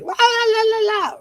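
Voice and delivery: high la la la mocking her own voice; mock high sing-song. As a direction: squeak it out like a chirpy cartoon. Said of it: "my voice is probably like".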